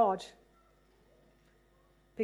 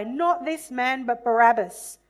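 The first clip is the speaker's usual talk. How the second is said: crowd shout; 7 dB louder than usual. Acted. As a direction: call out Barabbas over the crowd. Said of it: "They shouted in reply".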